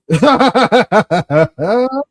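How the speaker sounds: loud laugh. Real, not acted. Laughs at own joke.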